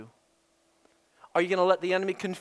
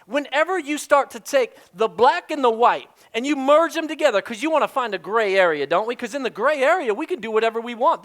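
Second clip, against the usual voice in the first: louder, driving sermon delivery; preaching; 4 dB louder than usual. Acted. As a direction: preach it with force to the congregation. Preaching at full force.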